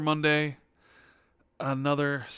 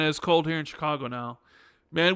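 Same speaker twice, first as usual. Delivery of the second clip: ordinary talk, nothing marked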